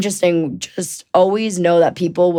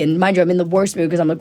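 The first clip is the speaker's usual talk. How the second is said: normal talking voice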